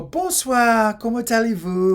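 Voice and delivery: high, bright French greeting; cheery greeting. Retold or imitated. Carol greeting shopkeepers, high cheerful French. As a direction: sing out a friendly hello. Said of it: "And she would go".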